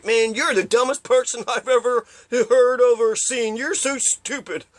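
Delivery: high, slow sneering taunt; drawn-out mock jeer at someone; mocking insult.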